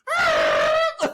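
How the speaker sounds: high burst of helpless laughter; a shrieking laugh fit; loud laugh. Real, not acted. Cracking up at his story.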